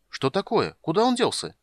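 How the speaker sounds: high, puzzled questions; bewildered. A narrator's reading. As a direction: pitch climbing in bafflement. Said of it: "недоуменно оглядывался Тасельхов".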